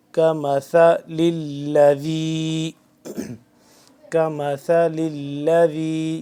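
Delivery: slow كَمَثَلِ الَّذِي with soft ث and ذ; soft tha and dhal. Shown as a demonstration. Teacher modelling soft letters for students, slow recitation. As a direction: keep ث and ذ of كَمَثَلِ الَّذِي light. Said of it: "ثا نرمی سے".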